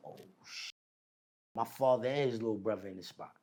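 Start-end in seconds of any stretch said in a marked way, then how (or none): 0.1-0.7 hushed oh shit. Retold or imitated. Reacting on hearing the prison name.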